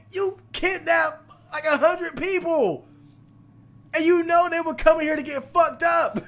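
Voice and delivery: outraged rant with laughter breaking through; indignant rant. Real, not acted. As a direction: lay out his crimes, outraged. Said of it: "I'm mad".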